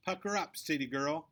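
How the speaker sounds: high, loud mocking taunt; mean girl sneering at the new kid. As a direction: sneer it to humiliate her. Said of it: "sneers Victoria".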